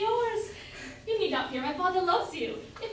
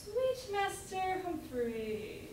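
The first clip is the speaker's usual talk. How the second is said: tender echo; 9 dB quieter, much slower than usual. Acted. Luce tenderly echoes his name.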